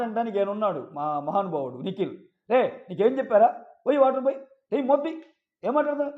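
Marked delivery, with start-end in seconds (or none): 2.5-6.2 raised pitch, mock telling-off; imitated scolding. Contestant takes her anger out on teammate, imitated scolding. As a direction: scold him in a higher, pushy voice.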